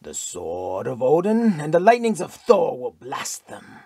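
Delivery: fanatic Norseman. A narrator's reading.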